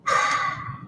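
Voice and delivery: long unvoiced exasperated sigh; heavy sigh. Real, not acted. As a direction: let out a weary, heavy sigh. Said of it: "That heavy sigh gives it away".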